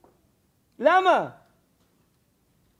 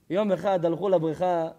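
ordinary talk

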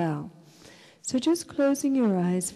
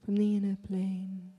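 guided meditation; 4 dB quieter than usual. Real, not acted. Leads a breathing meditation.

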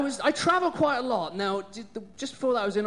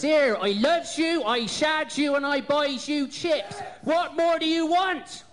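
put-on raised West Country accent, pitched up and called out; Somerset yokel shout; 6 semitones higher than usual. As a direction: bellow it like a Somerset bloke declaring love. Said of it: "the guy shouted to her".

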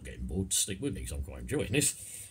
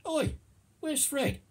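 much higher calling "Oi"; 3 dB louder, slower than usual. Retold or imitated. Ladder carrier in the ad calling out for missing Fred. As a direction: yell down the ladder for a mate. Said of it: "the middleman looks round and goes".

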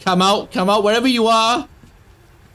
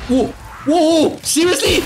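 high-pitched, incredulous whoas; startled, rising to a question; startled disbelief; 9 semitones higher than usual. Real, not acted. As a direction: react in real alarm, pitch jumping up. Surprised by in-game event.

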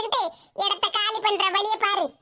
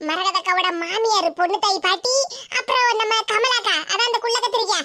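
usual speaking voice

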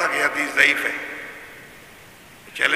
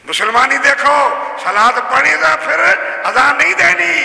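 mocking; 8 semitones higher, 6 dB louder than usual. Mocking opponents' ruling.

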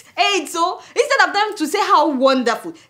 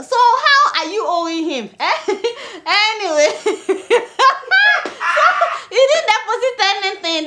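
laughing; 7 semitones higher than usual. Real, not acted. Laughing at absurd debt claim.

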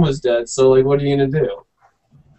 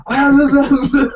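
mock rambling; 11 semitones higher, 3 dB louder, slower than usual. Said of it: "he's like".

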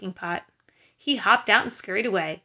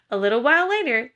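usual speaking voice

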